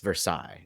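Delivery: "Versailles" said as ver-SIGH; French-style pronunciation. Retold or imitated. People correcting a Kentuckian, "Versailles" as ver-SIGH. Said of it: "people tell me ... it's pronounced".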